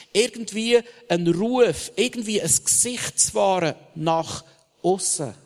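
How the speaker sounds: loud, high, driving sermon refrain; fiery preaching. Acted. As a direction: hammer the tree image home to the crowd. Sermon climax.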